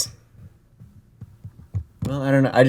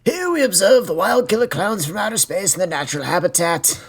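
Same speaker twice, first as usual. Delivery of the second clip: higher, hushed documentary narrator voice; nature documentary; 8 semitones higher than usual. Pretend. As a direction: narrate like a wildlife film. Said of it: "we go full, like, Planet Earth".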